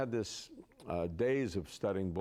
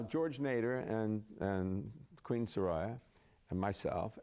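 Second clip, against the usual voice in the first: normal talking voice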